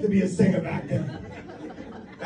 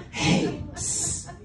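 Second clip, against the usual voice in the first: whisper; 5 dB quieter than usual. Retold or imitated. Street dealer whispering offer.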